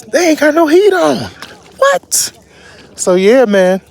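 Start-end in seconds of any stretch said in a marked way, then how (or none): 0.0-2.3 high-pitched, incredulous disbelief; incredulous complaint. Real, not acted. Traveler griping about restaurants with no heat, incredulous. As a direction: voice jumps way up in disbelief.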